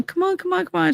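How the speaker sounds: high, soft pleading come on; mother calling out. Retold or imitated. Mother coaxing her son off the playground, high and soft. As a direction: coax the boy in a high soft voice.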